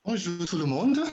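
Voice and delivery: high, bright French-sounding greeting. Real, not acted. Greeting the whole online audience in French, cheerful. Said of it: "That sounds Frenchy".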